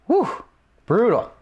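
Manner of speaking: stunned whew. Real, not acted. Reacting to a harsh insult.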